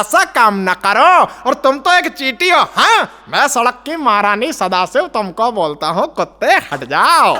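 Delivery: slow villain threat with swooping, rising pitch; theatrical Bollywood baddie warning someone off; Sadashiv Amrapurkar. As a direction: menace the dog, dragging out the line ends. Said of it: "Sadashiv અમરાપુરકર Saheb".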